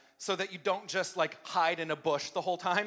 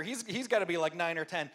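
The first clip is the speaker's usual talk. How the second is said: ordinary talk, nothing marked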